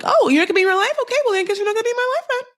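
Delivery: very high, squeaky mimicry of a petty spat; mock bickering. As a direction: race through it in a high mocking voice. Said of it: "they're both kind of doing this thing like".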